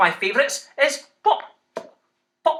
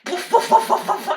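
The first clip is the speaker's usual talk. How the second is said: dog bark; 9 semitones higher than usual. Shown as a demonstration. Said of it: "what noise does a dog make".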